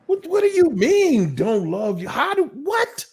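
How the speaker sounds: mock-wounded narcissist voice, pitched high; playing the victim. Imitating a narcissist who turns the blame into victimhood. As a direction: whine it out as the hurt, blameless one. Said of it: "see how I just made myself the victim".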